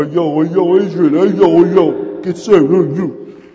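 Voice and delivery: very loud, high hollering in New York style; Rocky. Acted. New convert shouting at people, exuberant hollering. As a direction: exuberant street-corner bellow. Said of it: "I was like a Rocky".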